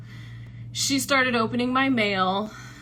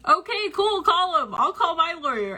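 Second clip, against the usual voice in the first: much higher, mocking breezy comeback; snarky retort; 7 semitones higher than usual. Answering the landlady's lawyer threat, snarky and mocking. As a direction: toss it back to her with sarcasm, much higher. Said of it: "I got really snarky with her".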